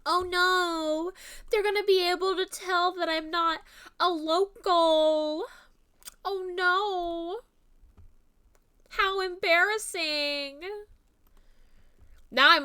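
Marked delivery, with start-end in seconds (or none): 0.1-10.6 high, hammed-up worry; playfully mortified, pitched up; mock dread. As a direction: fret in a high put-on voice. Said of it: "the thing that always scared me".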